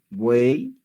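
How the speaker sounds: buey drawn to a rising "ee" ending; pronunciation demo. Shown as a demonstration. Teacher repeating for learners at home, clear final I in buey. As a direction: sound out the Y of buey as I. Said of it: "otra vez, vamos".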